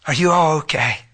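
ordinary talk, nothing marked

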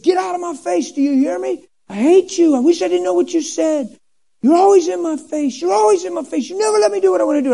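high, frantic imitation of a tantrum; mock screaming. Teenager freaking out at his Bible, mock screaming. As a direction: rant in a high pitch, speeding up at the end. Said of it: "I'm screaming at my Bible".

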